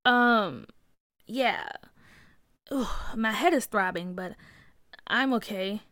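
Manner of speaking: slow, halting answer; dazed and recovering; groggy. Acted. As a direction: answer weakly with a throbbing head. Boy groggy after coming to.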